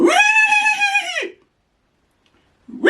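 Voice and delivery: very high, loud imitated neigh; horse whinny. Shown as a demonstration. Dad shows his baby the horse sound, high whinnying. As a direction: squeal out a long horse whinny. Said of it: "This is horse".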